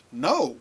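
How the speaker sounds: 'No' punched high and loud; forceful exclamation on 'No'; emphatic no. Shown as a demonstration. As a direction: hit 'No' hard like an exclamation mark. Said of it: "with an exclamation mark".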